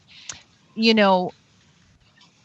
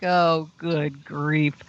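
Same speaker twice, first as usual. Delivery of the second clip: retold dismay; 4 semitones lower, 3 dB quieter, much slower than usual. Child's dismayed thought, retold.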